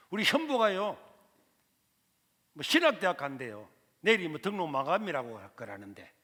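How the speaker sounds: 큰 소리로 애원하는 어머니; 아들 등록금 부탁하듯 크게 외치는 어머니 목소리; pleading mother. Retold or imitated. Mother pleads to rich uncle. (삼촌에게 아들 학비를 부탁하는 어머니, 크게 애원하듯)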